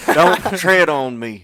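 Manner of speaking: slow, loud patriotic chant; loud slogan. Pretend. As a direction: declare it like a rally slogan.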